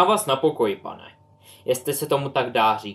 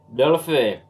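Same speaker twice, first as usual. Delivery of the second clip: louder call across the house; 5 dB louder than usual. A narrator's reading. Uncle calls his niece from the next room, loud. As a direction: call her name from afar.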